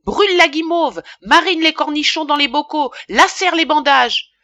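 high, loud, sarcastic tirade; furious rant. A narrator's reading. Apothecary rants at his careless apprentice, furiously sarcastic. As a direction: fire off each order in outrage.

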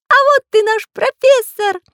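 very high, gleeful little character voice; delighted. A narrator's reading. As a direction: pipe up with joy, high and loud. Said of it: "обрадовался Карандаш".

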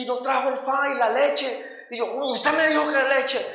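exasperated quarrel, replayed for laughs; retold bickering. Acted quarrel over forgotten milk.

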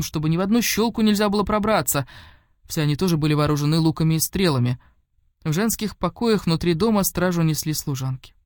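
usual speaking voice

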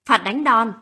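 put-on mild, narrated boyish shout; loud shout. A narrator's reading. Boy pronouncing his mother's punishment, mildly shouted. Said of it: "Thằng bé Bi bỗng hô to".

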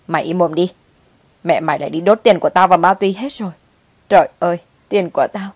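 scold, then wail about the money; angry lament. A narrator's reading. Grandmother silences the child over lost money, angry lament.

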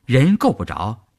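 ordinary delivery, nothing marked

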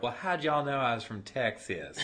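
thick imitated Texan accent, vowels stretched; Texan drawl. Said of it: "have Southern drawls".

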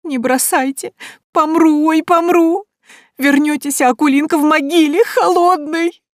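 high, loud tearful howl; keen like a peasant girl fearing death; wailing. A narrator's reading. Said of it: "Девушка тихонечко завыла". (служанка воет и причитает, высоко и громко)

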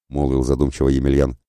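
usual speaking voice

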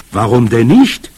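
loud, spirited retort; exclaiming. A narrator's reading. Grandmother answers the boy's doubt, exclaiming. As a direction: throw the question back forcefully. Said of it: "rief sie aus".

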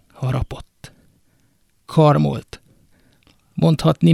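usual speaking voice

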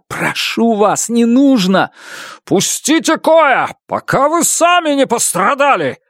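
desperate pleading. Acted. Narrator tries to stop fight.

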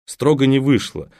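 ordinary delivery, nothing marked

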